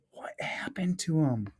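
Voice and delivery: hushed unvoiced question; soft suspenseful whisper. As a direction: whisper it like a secret to the kids.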